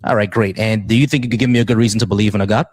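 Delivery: ordinary delivery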